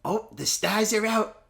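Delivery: breathless red carpet host gush; gushing red carpet chatter. Acted.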